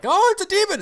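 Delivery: very high, loud alarmed cry; mock panic. Dragon hunter spotting a demon, hammed-up panic. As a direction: squeal it like a panicked henchman.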